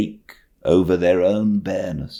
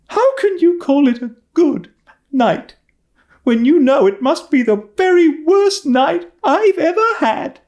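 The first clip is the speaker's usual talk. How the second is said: much higher, loud wail of reproach; reproachful cry; 6 dB louder than usual. Acted. Anne reproaching Marilla from her bed, high loud wail. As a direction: fling the hurt straight back at her. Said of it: "she said reproachfully".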